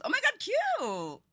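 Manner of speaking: excited coo. Real, not acted. Cooing at item.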